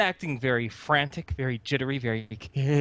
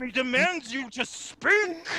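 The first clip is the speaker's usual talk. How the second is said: high, worked-up narration; high, insistent urging; excited demand; 11 semitones higher than usual. Acted. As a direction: keep the frantic pitch while narrating. Narration carried along in excited voice.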